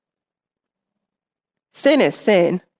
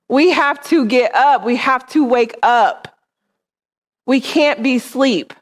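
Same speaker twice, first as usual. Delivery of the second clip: rally the church like soldiers; preaching with rising fervor; rousing exhortation; 4 semitones higher than usual. Acted.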